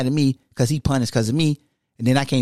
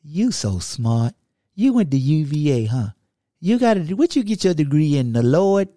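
ordinary delivery

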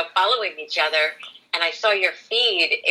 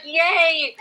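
excited cheer; 9 semitones higher than usual. Retold or imitated. I'm like, quoting own cheer.

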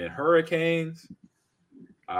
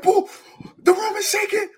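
high, loud amazed cry; excited kid voice; 5 dB louder than usual. Retold or imitated. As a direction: marvel like a kid at the show. Kid in retold earthquake story.

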